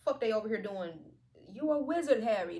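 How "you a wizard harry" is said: lightly acted film line, higher and louder; mock film quote. Reader tells Harry he is a wizard, mock film quote. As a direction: announce it like a hammed-up movie quote.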